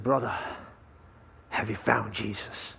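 a preacher quietly pressing the question; hushed pastor voice. Acted. Pastor quizzing the drunk after dunking.

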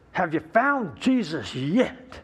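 slow, drawn-out pastor question; slow pressing pastor. Acted. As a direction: lean on every word, losing patience. Pastor asks a third time.

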